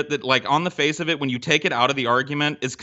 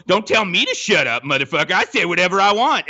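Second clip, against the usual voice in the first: retold anger; 3 semitones higher, 3 dB louder than usual. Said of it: "and I quote".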